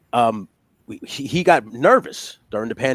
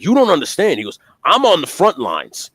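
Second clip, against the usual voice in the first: loud, raised, hammed-up earnestness; mock self-important; 6 semitones higher, 6 dB louder than usual. Grocery store friend to Tony, mock self-important. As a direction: imitate someone grandly overselling their duty. Imitates friend taking job too seriously.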